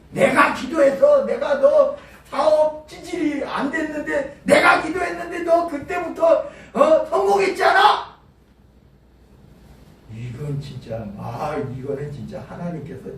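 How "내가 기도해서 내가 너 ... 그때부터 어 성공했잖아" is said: bragging pastor. Retold or imitated. Mocking boastful pastor.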